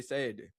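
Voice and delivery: "Saturday" squeezed short without the "er". Shown as a demonstration. Guest repeating the New Orleans way, "Saturday" without "er". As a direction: leave the R out of "Saturday". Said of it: "we don't even get the er".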